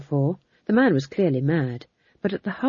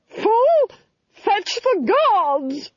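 high, loud, drawn-out shriek; imperious Duchess shrieking commands; yelled order; 5 dB louder, much slower than usual. A narrator's reading. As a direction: scream orders at a servant. Duchess yelling orders at the fool.

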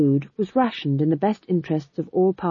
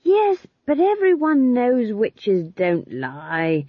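higher, slow, emphatic protest; earnest young witch; 6 semitones higher, 3 dB louder, slower than usual. A narrator's reading. Young witch answering Granny about witches' word, earnestly. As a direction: argue the point with a raised voice.

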